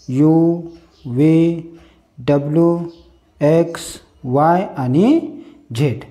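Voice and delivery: alphabet recited by letter name, evenly spaced. Shown as a demonstration. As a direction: recite each English letter name separately, with a pause after each. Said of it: "असेच वाचले असाल".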